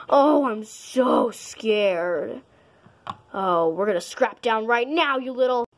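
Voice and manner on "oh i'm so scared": slow, mock-frightened drawl; sarcastic. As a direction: pretend to be scared. Sarcastic reply to a threat.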